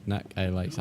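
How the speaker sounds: "Neck a lace" pulled apart, softly; split pronunciation. Retold or imitated. Streamer puzzling over chat's version, "Neck a lace" split in three. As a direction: sound out "Neck a lace" as three separate syllables. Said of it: "You say neck a lace".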